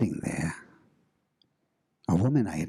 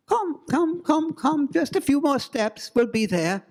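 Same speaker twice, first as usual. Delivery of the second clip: high, loud, coaxing ghostly call; beckoning ghost voice; 14 semitones higher, 3 dB louder than usual. Acted. Ghostly woman lures the couple through dark woods, high and coaxing. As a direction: lure them forward step by step. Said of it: "I heard that voice again".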